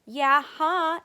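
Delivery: loud, high indignant protest; protesting kid. Acted. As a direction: insist loudly like a kid sister. Kids' quarrel.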